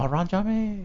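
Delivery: put-on high, soft Japanese-accented awe; mock Japanese tourist. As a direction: imitate awed Japanese tourists, softly. Imitates Japanese tourists greeting star.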